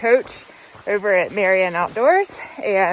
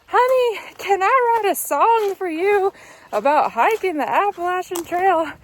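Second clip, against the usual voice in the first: bad Southern [name] accent, much higher; 8 semitones higher than usual. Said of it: "That is not a good [name] accent".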